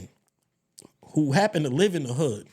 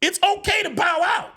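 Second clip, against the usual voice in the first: excited shout; 10 semitones higher than usual. Real, not acted. Excited podcast rant about fighting.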